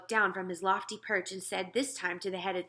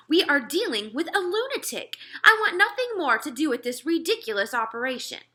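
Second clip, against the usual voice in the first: loud higher outburst of fury; furious cry; 8 semitones higher, 8 dB louder than usual. Acted. General insulted by the giant, loud furious cry. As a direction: blow up at the Queen in a rage. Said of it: "he cried".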